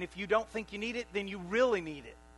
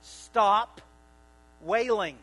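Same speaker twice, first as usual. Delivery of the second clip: firm command; 8 dB louder, much slower than usual. Retold or imitated. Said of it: "Jesus tells them".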